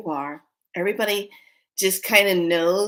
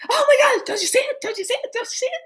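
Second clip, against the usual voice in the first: mock panic; 4 dB louder, faster than usual. Shoppers pranking the xenomorphs, mock panic. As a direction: squeal urgently in fake alarm.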